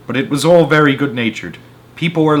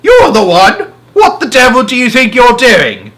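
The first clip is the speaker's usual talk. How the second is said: high, loud furious shouting; angry bellow; 11 semitones higher, 8 dB louder than usual. A narrator's reading. Policeman at the door bellowing angrily at Henry. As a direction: roar the accusation.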